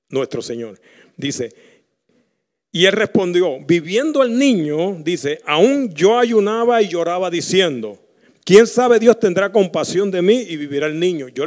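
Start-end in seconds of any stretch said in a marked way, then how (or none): 2.7-11.3 loud, rising preacher voice; fiery sermon delivery; preaching at full force. Acted. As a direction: drive the story home from the pulpit. Preaches David's answer.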